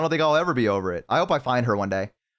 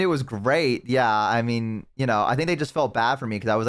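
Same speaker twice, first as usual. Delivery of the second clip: usual speaking voice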